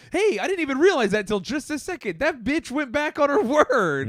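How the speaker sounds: high-pitched, playful outrage; mock indignant realization. Comic realizing his date broke her word, playfully indignant. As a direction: protest with a laugh breaking in.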